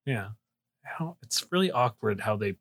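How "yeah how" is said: normal talking voice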